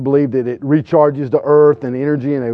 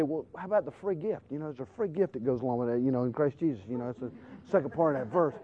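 gentle reply; 2 semitones lower, 17 dB quieter than usual. Retold or imitated. Gentle reply to shouting preacher.

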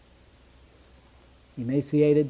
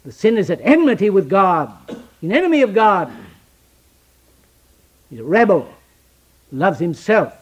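preaching; 4 semitones higher, 9 dB louder than usual. Acted. Preaching at full force.

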